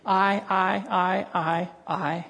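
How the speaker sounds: echoed "I" trailing off; repeated I, fading. Shown as a demonstration. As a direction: let the "I" die away. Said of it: "the emphasis on I".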